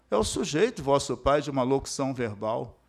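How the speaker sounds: ordinary talk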